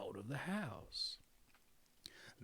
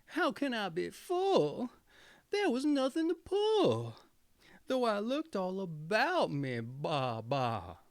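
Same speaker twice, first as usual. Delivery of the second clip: high, bleating goat voice, sly and sulky; a cunning goat whining that she's starving; 5 semitones higher, 4 dB louder than usual. A narrator's reading. The goat's bleating complaint.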